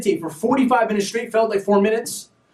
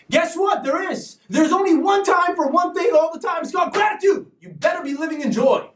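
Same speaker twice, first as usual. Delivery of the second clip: fired-up preaching; 9 semitones higher than usual. Real, not acted. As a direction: preach it hard to the crowd. Preaching gratitude to viewers.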